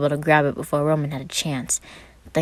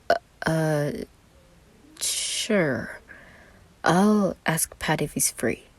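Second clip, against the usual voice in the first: hesitant; much slower than usual. Acted. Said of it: "seemed hesitant".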